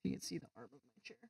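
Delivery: quick whispered aside; hushed aside. Real, not acted. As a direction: whisper it fast.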